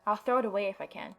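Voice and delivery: usual speaking voice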